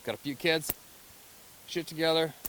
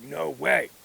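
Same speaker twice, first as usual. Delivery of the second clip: acted amazement; 4 semitones higher, 7 dB louder than usual. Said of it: "Isn't that amazing?".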